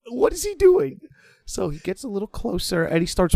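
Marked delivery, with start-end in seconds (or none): 0.1-1.0 high, alarmed inner question; sudden dread in the voice; high alarmed whisper of a thought. Retold or imitated. Stomach sank, alarmed thought.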